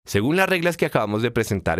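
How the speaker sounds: ordinary talk, nothing marked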